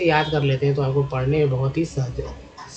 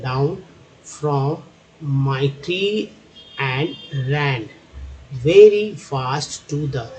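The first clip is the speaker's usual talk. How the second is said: very slow reading, a pause between words; drawn-out, deliberate model reading; reading demonstration; much slower than usual. Shown as a demonstration. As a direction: read word by word for beginners. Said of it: "कैसे मैं पढ़ता हूँ".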